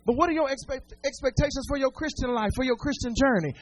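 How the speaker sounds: ordinary talk, nothing marked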